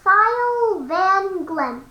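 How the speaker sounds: "Sylvan Glen" drawn out very slowly; very slow careful. Real, not acted. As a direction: spell out each sound after the stumbles. Finally gets the tricky word out.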